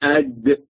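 "أد" as a hamza leading into a closed, light d; dal after hamza. Shown as a demonstration. Teacher repeats the letter for students, hamza before dal. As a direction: sound out "أد", stopping on the d. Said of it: "ضع قبله همز".

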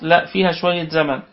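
ordinary talk, nothing marked

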